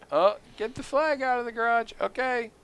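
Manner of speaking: high-pitched, hammed-up flag handler; mock alarm. Joking about a flag that summons immigrants, high mock alarm. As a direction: play panicky surprise in a high voice.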